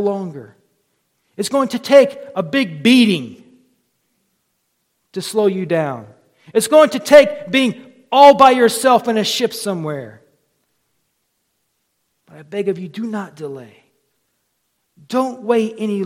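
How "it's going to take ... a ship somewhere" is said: louder, driving warning; forceful preaching. Acted. Preacher warning stubborn sinners, forceful preaching. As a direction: press the warning home.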